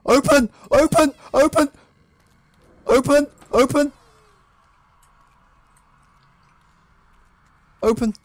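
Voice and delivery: high, loud desperate yells at the door; panicked player shouting at a locked door; frantic shouting. Real, not acted. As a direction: scream at the door to open. Door won't open during chase.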